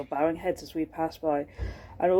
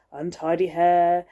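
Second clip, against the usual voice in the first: ordinary delivery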